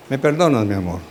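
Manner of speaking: tender apology. Acted. Sweet apology to spouse.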